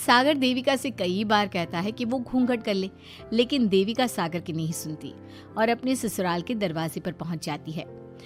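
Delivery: plain reading voice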